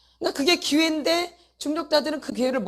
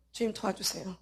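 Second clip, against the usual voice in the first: soft pleading prayer; 5 semitones lower, 10 dB quieter than usual. Retold or imitated. Voicing a believer's prayer.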